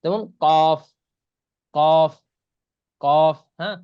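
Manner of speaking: কফ punched loud from the back of the tongue; Arabic qaf demo. Shown as a demonstration. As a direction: pop কফ out hard. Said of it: "লাগাইয়া কফ উচ্চারণ করতে হয়".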